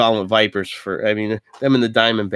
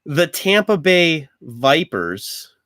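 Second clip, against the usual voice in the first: announcer voice; 4 semitones higher, slower than usual. Pretend. Announcing team names.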